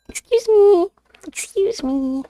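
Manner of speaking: very high-pitched mock indignation; squeaky playful scolding. Streamer tells off terror of a cat, squeaky scolding. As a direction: squeal it in tiny pet-talk falsetto.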